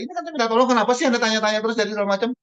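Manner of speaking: high, indignant, rushing complaint. Acted. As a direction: pitch jumps up in mock offense. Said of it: "menaikkan intonasi suara mereka". (nada tinggi tersinggung, cepat)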